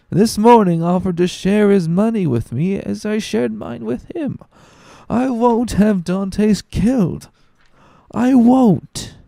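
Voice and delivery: put-on very high, slow, wobbling drunk outrage; a sloshed friend refusing, dragging every word; high drawn-out drunk indignation; drunken protest.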